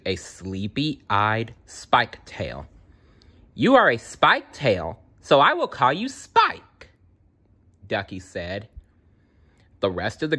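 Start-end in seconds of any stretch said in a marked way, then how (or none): 3.5-6.9 high, eager character voice; excited young dinosaur. A narrator's reading. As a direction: announce it with childlike delight. Ducky excitedly names new friend.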